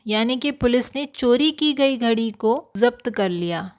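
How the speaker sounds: normal talking voice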